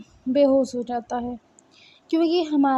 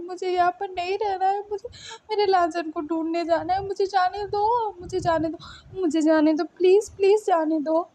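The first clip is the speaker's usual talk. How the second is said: desperate pleading; 4 semitones higher than usual. Acted. Wei Ying begs the others to let him go find his beloved, desperate pleading. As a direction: voice climbing into a higher, urgent plea. Acted desperate plea in a story.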